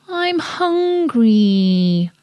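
put-on slow whiny little-girl voice; hungry little girl. A narrator's reading. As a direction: drag it out like a hungry child. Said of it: "said Rosie".

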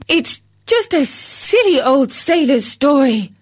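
high scornful dismissal; scoffing wife. Acted. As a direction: wave off a silly tale. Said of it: "said his wife".

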